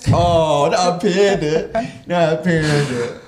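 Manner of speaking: high, slow, loud "not Panda"; mock outrage. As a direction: drag out the horrified protest. Mock horror at Panda Express.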